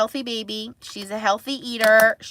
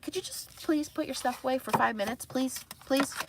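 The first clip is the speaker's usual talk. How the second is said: put-on softer, pleading please-please to a messy kid; exasperated pleading; 4 semitones higher, 8 dB quieter than usual. Mom pleading with daughter to tidy, exasperated softly. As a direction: beg the kid, worn out.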